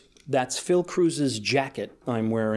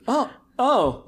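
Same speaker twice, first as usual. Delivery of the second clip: higher, sudden oh of understanding; sudden penny-drop; mock realization; 11 semitones higher, 4 dB louder than usual. Actor realising the accent was put on, higher. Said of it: "He goes".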